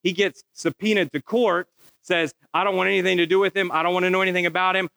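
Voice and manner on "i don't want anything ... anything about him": rapid-fire disowning, words run together; dismissive refusal. Retold or imitated. Biological father in court disowning the boy, hurried and dismissive. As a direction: rattle it off to be rid of the matter.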